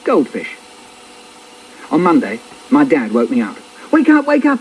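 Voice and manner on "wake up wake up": high, urgent wake-up shout. Acted. Dad waking child on Monday, urgent high shout. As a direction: shout to rouse a sleeper. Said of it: "he shouts".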